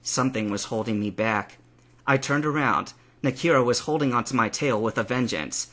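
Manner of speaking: usual speaking voice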